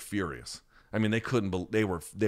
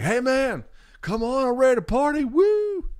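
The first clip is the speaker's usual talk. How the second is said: very high, loud mock party holler; hammed-up excited phone message; party-guy voicemail; 6 dB louder than usual. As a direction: whoop it up like a buddy ready to party. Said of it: "Because the first one is like".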